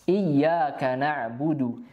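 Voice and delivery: "Iyyaka na'budu" slow, doubled y held; slow careful "Iyyaka na'budu". Shown as a demonstration. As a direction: lean on the y of "Iyyaka". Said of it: "membaca dengan tepat iyyaka".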